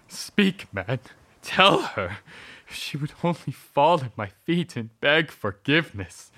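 loud, high, torn by love; anguished outcry. Acted. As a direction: shout through heartbreak. Torn husband cries out.

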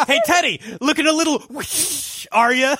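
high teasing taunt to Teddy; mock taunt. Mocking Teddy about getting shot, in a high teasing voice. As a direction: needle him in a high mocking voice. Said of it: "taunting Teddy in a voice".

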